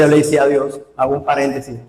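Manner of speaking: usual speaking voice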